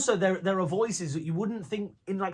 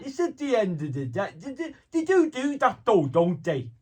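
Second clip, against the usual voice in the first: louder Scouse John Bishop voice; 6 semitones higher, 7 dB louder than usual. Shown as a demonstration. A Scouse John Bishop voice shown to the interviewer. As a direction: talk round a swollen tongue like John Bishop. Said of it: "that's how John Bishop talks".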